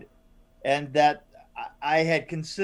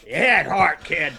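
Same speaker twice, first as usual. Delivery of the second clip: Jeremy Brown impression; 6 dB louder than usual. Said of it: "you doing a Jeremy Brown impression".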